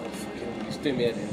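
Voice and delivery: low, soft, under-the-breath moan; quiet irritated aside; mock muttered grumble. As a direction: grumble it to yourself, fed up. Muttering his annoyance at bandmate.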